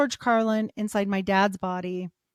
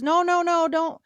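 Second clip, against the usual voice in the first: higher, louder, rapid-fire no's; urgent protest; 8 semitones higher, 4 dB louder than usual. Retold or imitated. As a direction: cut in to stop someone, voice raised. Doctor rushing to stop the nurse.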